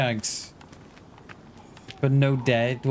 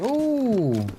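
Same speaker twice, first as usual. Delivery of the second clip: high, silly voice for careless players; mock high dopey voice; 9 semitones higher, much slower than usual. Mocking careless players who plant bear traps, high silly voice. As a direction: squeal it like a clueless teammate planting a trap. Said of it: "They're like".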